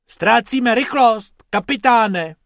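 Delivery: high, loud, drawn-out report; urgent alert. A narrator's reading. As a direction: call it out to the captain. Crewman alerts the captain in flight.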